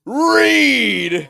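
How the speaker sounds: high, loud yelled correction; shouting. Acted. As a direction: bellow the name. Yells the name again.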